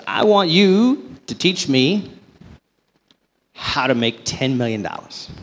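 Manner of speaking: put-on slow, drawn-out southern accent; southern drawl. A billionaire asking the young banker, in a southern drawl. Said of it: "a little southern drawl".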